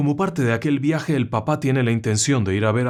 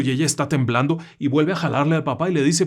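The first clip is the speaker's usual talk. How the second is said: ordinary delivery, nothing marked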